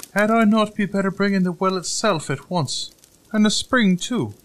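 high, loud, cocky little voice; boastful tailor. A narrator's reading. Tailor showing off to the giant, high and boastful. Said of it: "asked the boaster".

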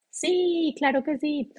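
put-on quick bright "yes" as the kids; children answering. Children answer mother's question. (niños responden con voz más aguda)

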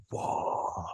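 breathless whispered whoa; awed. Retold or imitated. Moviegoer struck in the theater, whispered awe. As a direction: let the awe steal your voice.

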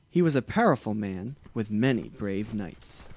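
plain reading voice

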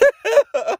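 high, squealing giggle; high-pitched laugh. Real, not acted. Overwhelmed laugh after Jesus.

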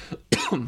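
a few rough coughs; coughing fit. Real, not acted. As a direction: cough hard into the mic. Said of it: "I'm coughing a bunch all of a sudden".

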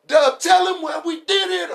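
high, loud pleading shout; imitating shouted plea. Friend screams at Dub to explain, high and loud. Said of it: "And he's like".

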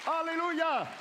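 high, loud cry of praise; preacher's shout. Real, not acted. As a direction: shout it out to the congregation. Preacher calls out to church. (grito de alabanza fuerte y agudo)

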